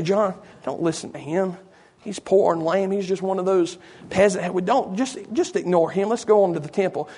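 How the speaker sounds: quietly steer your companion away; dismissive. Acted. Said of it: "if that was his attitude".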